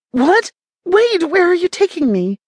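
sudden panic as the door closes; alarmed. A narrator's reading. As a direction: captive woman panicking as the van leaves. Van door shut, she panics.

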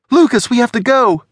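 high, loud urgent call; urgent shout. A narrator's reading. Alex urges Lucas to flee.

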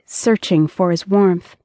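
plain reading voice

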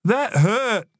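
high-pitched little brother's whine; hurt complaint. A narrator's reading. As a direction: protest like a hurt kid. Hurt boy complains on the ground.

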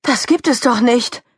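high-pitched, indignant grumble; mild scolding. Acted. Mickey Kuchen finding the meatballs gone, scolding. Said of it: "schimpfte Mickey Kuchen".

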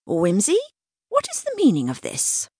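put-on high-pitched imperious old aunt; indignant aunt, voice pitched right up; Demetria, demanding. A narrator's reading. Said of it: "Demetria demanded".

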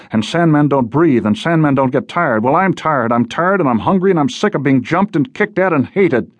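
high-pitched, mounting fed-up tirade; exasperated outburst. Acted. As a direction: pile up the complaints, pitch climbing. Logan's fed-up outburst at her.

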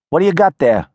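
put-on wary girl calling from a porch; tough city girl. Nadia voiced by narrator.

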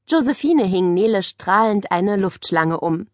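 plain reading voice

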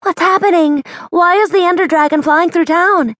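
high, loud frightened questions; panicked, calling out over the chaos; alarmed. Acted. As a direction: townsfolk alarm at a dragon. Hannah alarmed by dragon.

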